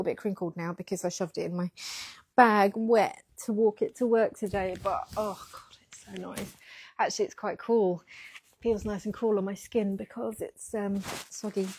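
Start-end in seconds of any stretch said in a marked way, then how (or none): 5.2-6.5 breathy whispered delight, voice dropping away. Real, not acted. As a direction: whisper it like a guilty pleasure. Savouring soft wet sweater.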